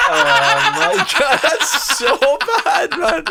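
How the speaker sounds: high laughing disbelief; laughing hard. Real, not acted. Friend reacting to the dirty joke, laughing hard. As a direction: laugh your way through the words.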